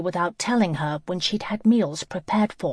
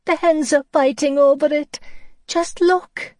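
put-on high child voice; 9 semitones higher, 6 dB louder than usual. Child laments lost pastry.

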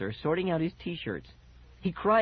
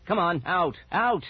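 loud, higher order to leave; angry, raised-voice command; sharp demand; 5 semitones higher, 4 dB louder than usual. A narrator's reading. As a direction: shoo them off firmly. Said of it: "my father demanded sharply".

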